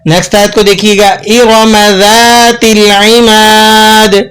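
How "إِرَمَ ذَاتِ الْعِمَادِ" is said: slow melodic tajweed recitation; Quran recitation. Shown as a demonstration. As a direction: recite the verse with measured melody. Recites verse twice.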